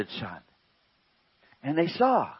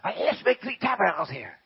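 stammering Peter; 7 semitones higher than usual. Retold or imitated. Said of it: "Peter stammered out".

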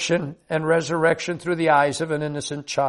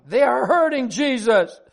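high-pitched mock shout, climbing higher; pleading, outraged high cry; child's distressed shouting; 7 semitones higher than usual. A narrator's reading. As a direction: act out a little girl protesting desperately. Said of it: "she kept shouting".

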